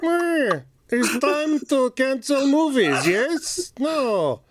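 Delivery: high hammy cartoon Russian; cartoon villain playing magician; villain Russian accent. Zaslav as Bullwinkle.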